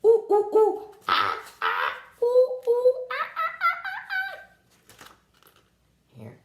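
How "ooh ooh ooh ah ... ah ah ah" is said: monkey calls with a laugh; monkey chatter. Pretend. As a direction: hoot like a playful monkey. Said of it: "don't forget to chatter".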